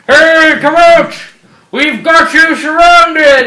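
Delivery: loud, high, stretched-out calling from far above; police shout. Acted. Cops with searchlights calling down to the fugitives, police shout. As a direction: holler it like a cop at cornered fugitives.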